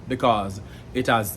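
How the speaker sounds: ordinary talk, nothing marked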